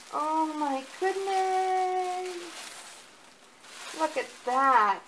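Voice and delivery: high, loud, drawn-out oh my goodness; overwhelmed with delight at the gift; thrilled gasp. Real, not acted. Unboxing her reborn doll, squealing with delight.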